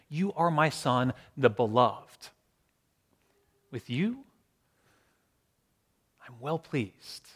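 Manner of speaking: slow and softening, with long pauses; tender reassurance. Acted. God reminding Jesus who he really is, tender. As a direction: reassure gently, letting it fade.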